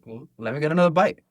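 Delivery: eager. Retold or imitated. Eager retold reaction.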